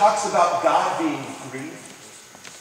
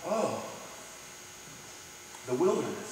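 hushed sorrow; 4 semitones lower, 15 dB quieter than usual. Pretend. Jesus recalls the wilderness.

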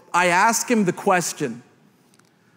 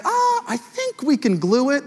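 hesitant doctor; 8 semitones higher than usual. Retold or imitated. Doctor examining a child's gash, high hesitant voice. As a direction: imitate a doctor hedging.

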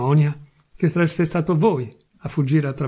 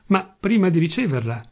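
plain reading voice

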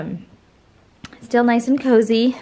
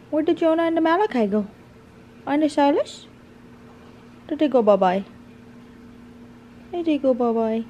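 talking to a dog; 3 semitones higher than usual. Real, not acted.

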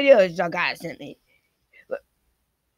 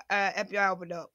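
lower, softer mock agent voice; mock FBI agent, lower and softer; 6 semitones lower, 7 dB quieter than usual. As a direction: play the raiding agent low and quiet. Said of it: "blast into my house and say".

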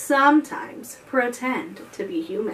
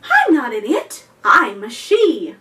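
louder, huffy correction; indignant mermaid; 8 dB louder than usual. Acted. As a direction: set the kids straight, offended. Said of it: "the something replied".

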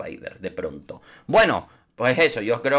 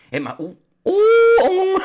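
mock sobbing; 7 dB louder, much slower than usual. Fake sob turning into laughter.

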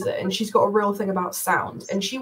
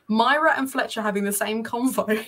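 normal talking voice

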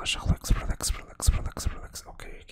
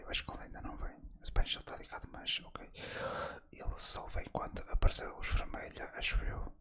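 hushed, unvoiced gaming commentary; murmured play-by-play, all in whispers; ASMR whisper; 3 semitones lower than usual. Real, not acted. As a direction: whisper close to the mic for an ASMR video.

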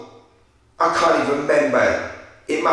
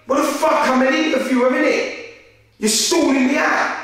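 exasperated high-pitched telling-off; indignant retold rant; 9 semitones higher, faster than usual. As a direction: let him have it for stalling you. Angry phone call, stalled interview.

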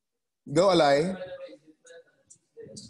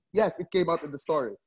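ordinary talk

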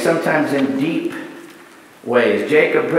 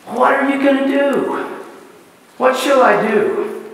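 searching question; 7 semitones higher than usual. Acted. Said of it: "preacher challenges congregation".

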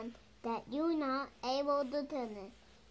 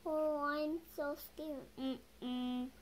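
plain reading voice

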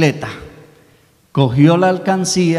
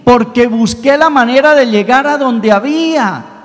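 fervent preaching; 7 semitones higher than usual. Acted. Preacher tells the congregation what prayer is, fervent preaching. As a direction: drive the point home to the congregation. Preacher drives home the lesson.

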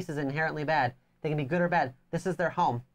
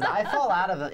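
ordinary talk, nothing marked

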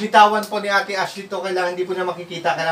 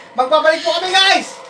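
higher, louder host sign-off; excited sign-off; 9 semitones higher, 5 dB louder than usual. Real, not acted. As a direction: pump up the outro. Vlog sign-off rising.

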